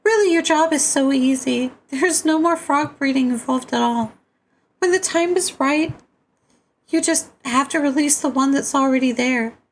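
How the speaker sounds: very high put-on voice giving instructions; high-pitched otherworldly character. Character briefing players on the frog's journey, high put-on voice. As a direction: pitch it far up, sustained and steady.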